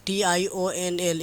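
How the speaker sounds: letters spelled out slowly one by one; slow, deliberate spelling for students. Shown as a demonstration. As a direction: spell each letter slowly and clearly, like dictation. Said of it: "intentional questions".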